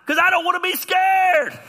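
hammed-up scared refusal at full voice; loud protest. Acting out a frightened refusal.

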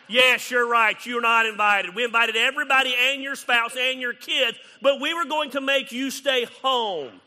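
mock them with exaggerated agreement; sarcastic mock reply. Sarcastic retort to sulker.

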